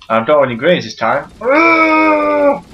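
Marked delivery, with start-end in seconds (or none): 1.4-2.6 long high-pitched cry; high groan. Real, not acted. Gamer in a grenade fight, long high cry. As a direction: let out a long, high wail.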